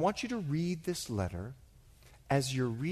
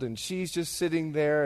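usual speaking voice

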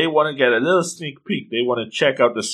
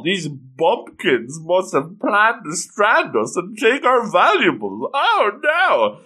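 hammed-up indignation climbing higher and higher; high and mighty, unhappy; 7 semitones higher, slower than usual. High and mighty girl accusing the bumpkins, mock outraged. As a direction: play a snooty girl in a mock fluster. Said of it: "they're not happy with this at all".

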